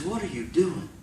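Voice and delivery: soft, questioning; hushed divine voice. Retold or imitated. God speaking to a suicidal man, hushed. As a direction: ask it softly, as a voice from nowhere.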